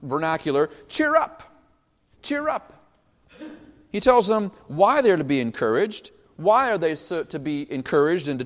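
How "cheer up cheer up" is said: high, breezy everyday encouragement; light chummy reassurance; casual cheer up. Acted. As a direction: toss it off like a friend. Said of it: "We might even say in our vernacular".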